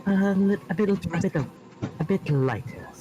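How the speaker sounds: a lightly posh heiress voice; Aria's voice. Shown as a demonstration. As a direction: speak like a refined heiress. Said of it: "I have to speak like this".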